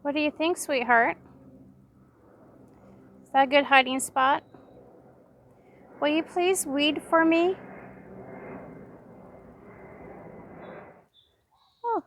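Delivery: high, sing-song pet talk. Real, not acted. Gardener greets her chickens in a high sweet pet voice. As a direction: coo sweetly at the hens.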